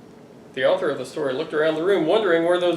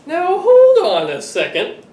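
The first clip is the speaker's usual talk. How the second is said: mock protest; 9 semitones higher, 5 dB louder, slower than usual. Author objects to interruption.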